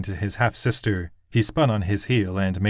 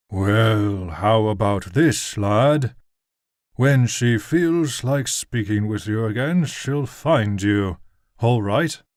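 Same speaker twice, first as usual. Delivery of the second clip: menacing nobleman; slower than usual. A narrator's reading. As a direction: lean in and threaten, slowly. Nobleman threatens the guardsman.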